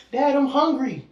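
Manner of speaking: put-on high-pitched whining kid; child calling Dad. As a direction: imitate a hungry child pestering a parent. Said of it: "somebody like".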